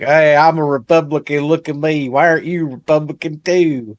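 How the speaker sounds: high, loud put-on voice; hammed-up badgering in a mock character voice; pushy political converter. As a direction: play someone pestering others to convert. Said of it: "That'd be like me being like".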